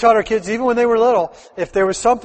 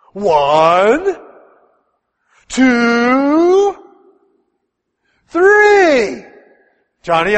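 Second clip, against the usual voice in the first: angry counting; 7 semitones higher, 6 dB louder, slower than usual. Retold or imitated. Mom counting at disobedient child.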